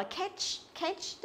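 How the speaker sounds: "catch" with a narrow e, like "ketch". Shown as a demonstration. Teacher mimics the common mistake, "catch" as "ketch". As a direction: close the vowel of "catch" to a plain e. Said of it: "tức là đọc thành âm E rồi".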